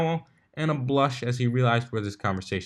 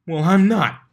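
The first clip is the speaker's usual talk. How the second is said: louder, higher huff; huffy; 9 semitones higher, 10 dB louder than usual. A narrator's reading. Pegasus refusing to talk about it, huffing. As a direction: snap it back, irritated. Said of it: "Blitz huffed".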